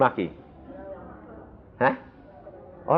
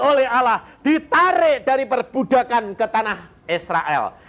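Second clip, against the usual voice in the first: slow, drawn-out sermon cadence starting high; oratorical preaching; 11 semitones higher, 3 dB louder, slower than usual. Real, not acted. Preacher recounts Israel's chosen nation to congregation, oratorical. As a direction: stretch each phrase out from the pulpit, settling gradually.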